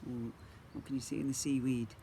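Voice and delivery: usual speaking voice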